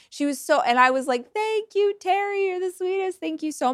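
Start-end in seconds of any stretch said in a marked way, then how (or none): 1.3-3.7 high, gushing, awkward thank-you; bashful high-pitched gratitude to a kind neighbor. Retold or imitated. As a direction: squeak out thanks, flattered and embarrassed. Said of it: "feeling awkward".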